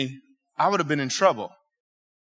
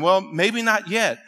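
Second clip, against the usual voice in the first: ordinary talk, nothing marked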